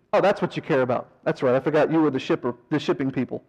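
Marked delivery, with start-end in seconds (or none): none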